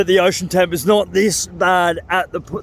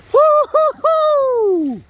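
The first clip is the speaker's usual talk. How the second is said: drawn-out very high woo-hoo; long high whoop; much slower than usual. Real, not acted. Exhilarated after icy plunge.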